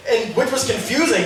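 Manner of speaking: normal talking voice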